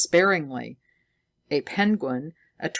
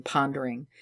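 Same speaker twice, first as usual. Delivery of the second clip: plain reading voice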